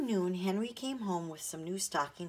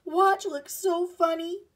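much higher, louder and slow; laughing call; 12 semitones higher, 11 dB louder, slower than usual. A narrator's reading. Jessie calling to Violet about the funny-looking dog. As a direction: call it out through amusement.

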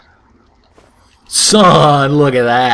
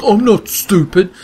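voiced NPC; 5 semitones higher than usual. Voicing NPC reply to insult.

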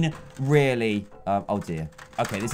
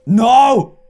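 loud, high panicked cry; real fright; scared shout; 6 semitones higher, 9 dB louder than usual. Real, not acted. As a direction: yelp at the danger behind. Vents opening behind him.

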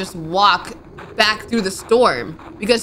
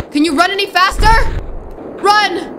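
higher, louder frantic urging; panicked urging; 10 semitones higher, 4 dB louder than usual. Real, not acted. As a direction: urge them to flee, panicking. Fleeing in panic.

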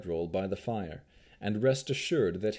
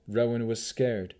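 ordinary delivery, nothing marked

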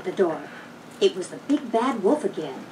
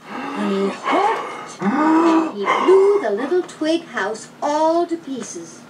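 slow, swelling louder and higher; building climax; 5 semitones higher, 3 dB louder, much slower than usual. A narrator's reading. Narrator builds the wolf's big blow, slow and swelling. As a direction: stretch it, then blow it all apart.

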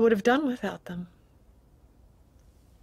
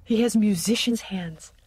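retold whisper. Wife retelling what she whispered to Leslie, hushed. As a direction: confide it like a secret across a bar.

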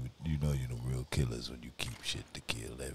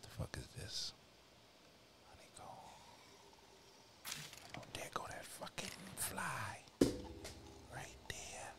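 hushed whisper; 12 semitones higher, 7 dB quieter than usual. Real, not acted. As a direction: whisper while sneaking. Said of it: "I don't know why I'm whispering".

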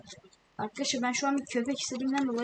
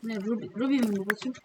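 ordinary delivery

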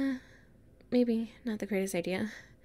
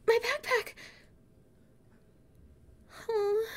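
high-pitched distress; dismayed cry; 11 semitones higher than usual. Acted. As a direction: cry out as the bag falls. Backpack falls into the depths.

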